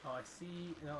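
ordinary talk

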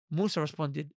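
ordinary talk, nothing marked

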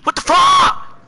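loud, high burst of outrage; frustrated yell. Real, not acted. Blocked player at the game, frustrated yell. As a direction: curse loudly in real frustration.

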